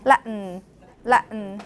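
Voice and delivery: Latin with the T caught in the throat; Latin: glottal stop straight into syllabic N. Shown as a demonstration. Teacher models own Latin for class, T as glottal stop. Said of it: "It's always Latin or Tibetan".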